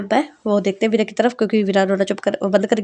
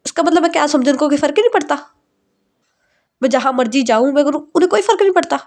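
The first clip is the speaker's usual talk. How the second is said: higher, rushed exasperated rant; frustrated venting; 5 semitones higher than usual. Acted. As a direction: pour out the hurt in a rush. Bride vents about fiancé.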